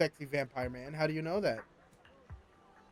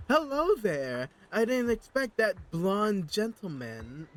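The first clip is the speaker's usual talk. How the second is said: slow, high character read; 6 semitones higher, 6 dB louder, slower than usual. Shown as a demonstration. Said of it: "All right".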